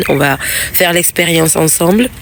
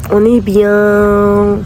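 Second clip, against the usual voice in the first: drawn-out contented sigh; much slower than usual. Real, not acted. Relaxed, savoring the warm day.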